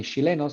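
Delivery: chileno said as "shileno"; Chilean sh for ch. Shown as a demonstration. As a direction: soften the ch of chileno to sh. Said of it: "si se pronuncia sh".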